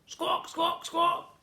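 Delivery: high squawking gull calls; seagull squawks. A narrator's reading. Seagulls struck by the slime ball, squawking high.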